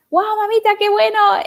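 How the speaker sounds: mom's voice, high and thrilled; mamá emocionada, voz aguda; excited mom. Retold or imitated. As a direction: play her proud mother, high. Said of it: "She's like".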